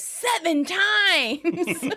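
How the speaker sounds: very slow, high, laughing exclaim; blurted. Retold or imitated. Chandler blurting to Monica how often it happened, slow and laughing. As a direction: stretch it out in disbelief.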